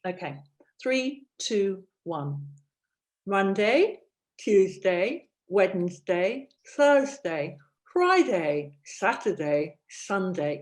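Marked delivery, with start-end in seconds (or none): 3.3-10.6 talking around a cork in the mouth. Shown as a demonstration. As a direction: days said with a cork between the teeth. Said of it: "So, cork in the mouth".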